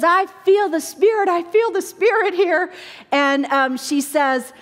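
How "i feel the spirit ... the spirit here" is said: moved woman. Retold or imitated. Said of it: "And the woman says".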